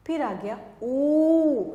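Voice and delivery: drawn-out long ऊ; slow, held-out ऊ sound. Shown as a demonstration. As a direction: stretch the ऊ slowly and long. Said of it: "यानी कि बड़ा ऊ".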